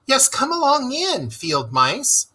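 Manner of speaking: high, eager welcome; eager Mole. A narrator's reading. Mole eagerly inviting the field mice in, high and eager. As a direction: bubble over with welcome. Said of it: "cried the mole eagerly".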